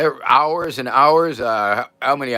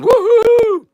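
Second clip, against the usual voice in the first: high, loud cheering whoop; excited whoop; 6 dB louder than usual. Real, not acted. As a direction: let out a big happy woohoo. Cheering on stream.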